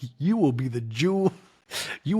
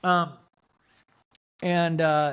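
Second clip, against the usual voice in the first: ordinary talk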